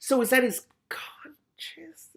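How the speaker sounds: slow, wondering whisper; puzzled whisper. Real, not acted. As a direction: whisper it as you work it out. Reader puzzling over the voice.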